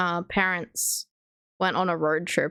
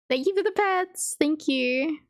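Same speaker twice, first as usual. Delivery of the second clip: higher cooing pet voice; talking to a pet; 7 semitones higher than usual. Real, not acted. Thanking the pet for cuddles.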